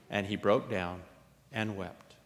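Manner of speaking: ordinary delivery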